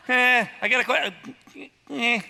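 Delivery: high, loud, fumbling nebbish stammer; Woody Allen. Retold or imitated. Gideon played as a nervous Woody Allen, stammering. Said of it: "Gideon's like Woody Allen".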